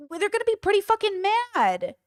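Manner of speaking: high-pitched disbelief, drawing out the point; incredulous retort. Retold or imitated. Streamer repeating the comeback to Ethan for chat, incredulous=. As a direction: repeat it high, as if it's obvious.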